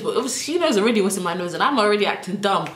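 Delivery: ordinary delivery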